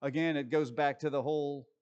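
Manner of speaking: normal talking voice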